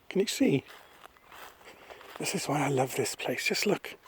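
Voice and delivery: softly, as if not to disturb; hushed, near whisper. Real, not acted. Showing viewers common orchids in a place she loves, hushed. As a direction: keep it down while sharing a find. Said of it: "I don't know why I'm whispering".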